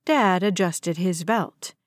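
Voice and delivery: usual speaking voice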